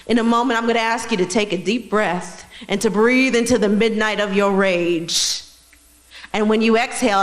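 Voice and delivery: loud, firm meditation guide; rally them into their rage; guided meditation. Acted.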